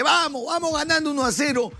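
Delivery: excited cheer. Retold or imitated. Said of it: "levanta al público y dice".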